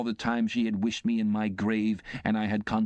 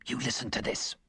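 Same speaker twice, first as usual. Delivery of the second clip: put-on stern, softer aside to the boy; a sharp hushed rebuke; severe aside; 5 semitones higher, 4 dB quieter than usual. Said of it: "in a severe parenthesis".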